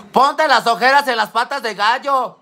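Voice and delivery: joking shout while applying cream; loud call. Real, not acted. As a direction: belt it out playfully. Loud call out mid-routine.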